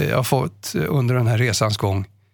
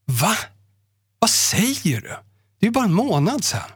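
shocked disbelief; 8 semitones higher than usual. Retold or imitated. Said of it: "sa jag".

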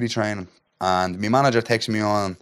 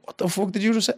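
much higher, rising, astonished; shocked disbelief; 10 semitones higher, 3 dB quieter than usual. Retold or imitated. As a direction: blurt it out, floored by the news. Retelling shock at UFC call.

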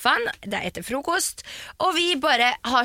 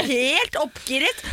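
usual speaking voice

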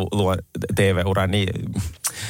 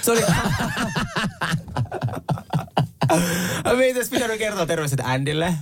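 higher-pitched, laughing as you talk; real laughter; 8 semitones higher than usual. Real, not acted. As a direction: crack up, then get the words out high. Hosts crack up over the mishap. (oikeasti nauraen)

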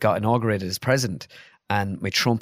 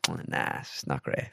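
very quiet, voiceless rejection; hushed, dismissive imitation; retold dismissal; 8 semitones lower, 10 dB quieter than usual. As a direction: murmur an unimpressed no. UK bosses rejecting the video.

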